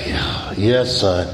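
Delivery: low, hesitant answer, imitating mom; wary surprise. Mother getting a rare call from son, wary surprise. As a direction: answer low, braced for trouble. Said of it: "she's surprised".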